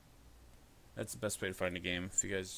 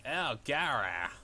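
Jimmy Fallon impression; slower than usual. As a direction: drag out the disgusted whine. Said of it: "That's from, uh, Jimmy Fallon".

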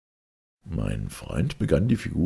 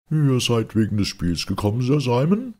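higher, honeyed mock-politeness; sugary sweet; 9 semitones higher, 4 dB louder than usual. A narrator's reading. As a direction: coat every word in sugar. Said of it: "die dem süßesten Zuckerguss alle Ehre".

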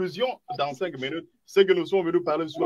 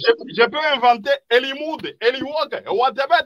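heated outburst; 5 semitones higher, 4 dB louder than usual. Real, not acted. Heated debate objection.

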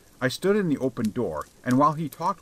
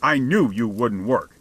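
louder, accusing outburst; restrained roar; 5 dB louder than usual. A narrator's reading. Man rebuking the waiting beggar, a restrained roar. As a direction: raise the voice in an understated roar. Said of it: "he roared".

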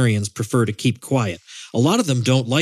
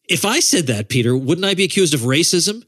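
sarcastic; 5 semitones higher than usual. Pretend. Mock-outraged rhetorical questions.

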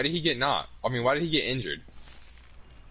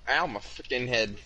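ordinary delivery

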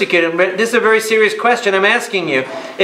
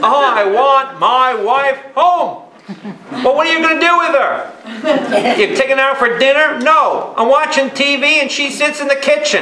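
higher, whining mock husband voice; complaining husband; 8 semitones higher than usual. Husband on the phone arguing with the priest, higher mock voice. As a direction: play the husband, pleading to get his wife home.

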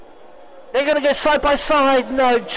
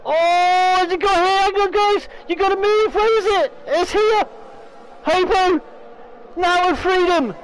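excited; 7 semitones higher than usual. Real, not acted. Hunting for the yellow flag.

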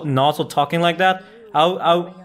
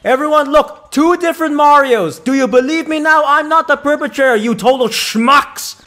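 indignant yelling; 10 semitones higher, 7 dB louder than usual. Real, not acted. Yelling at game characters.

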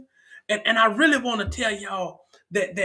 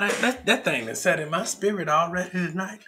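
ordinary talk

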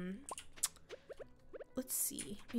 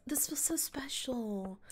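usual speaking voice

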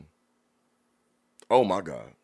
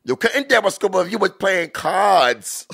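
mock rap line, higher, CARDS drawn out; stretched word; 8 semitones higher than usual. Host mocks a rapper padding out rhymes, "cards" stretched long. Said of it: "stretch out a one-syllable word".

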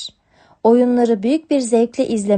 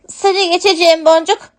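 higher, louder kitten calling ahead while racing; 8 semitones higher, 6 dB louder than usual. Acted. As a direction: kitten calling after her sister on bikes. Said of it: "diye seslenip duruyordu". (yavru kedi, bağırarak seslenerek)

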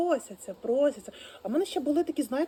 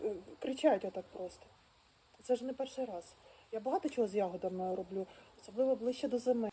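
ordinary delivery

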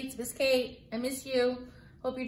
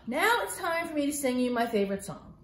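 plain reading voice

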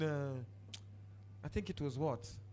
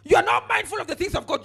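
forceful, louder rebuke of the crowd; 7 semitones higher, 6 dB louder than usual. Acted. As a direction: preach it as a sharp scolding. Preacher rebuking the congregation.

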